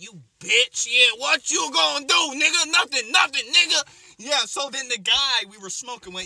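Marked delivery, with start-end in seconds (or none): 0.0-3.8 loud high yelling; cussing out. Retold or imitated. The lady retold yelling at the girl, loud. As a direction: yell her down, higher and louder. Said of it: "the lady who was like".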